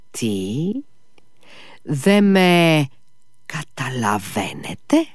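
reproachful drawl. A narrator's reading. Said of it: "πρόφερε μακρόσυρτα και επιτιμητικά".

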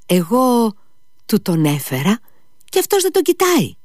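high-pitched, insistent calls to look; excited urging. A narrator's reading. Boy showing off his dog to a sick friend, excited urging. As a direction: pester them to watch, voice climbing high.

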